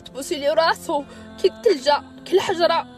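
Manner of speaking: tearful, voice breaking; crying through the words. Real, not acted. Kissing dead husband's head.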